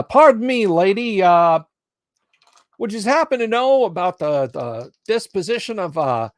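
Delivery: a pushy city slicker. Acted. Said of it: "his city slicker tone of voice".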